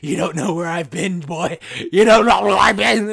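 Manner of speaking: scare them off with creepy menace; creepy threat. Pretend. Threatening a rude stranger, creepy loud taunt. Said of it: "I'll be like".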